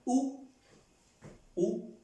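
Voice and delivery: "U" as French où, a short high oo; French question word. Shown as a demonstration. Teacher repeating the word for where, high questioning oo. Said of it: "en pregunta".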